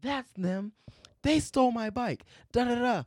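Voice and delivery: hammed-up voice of an accusing drunk lady. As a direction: mimic a drunk woman accusing strangers.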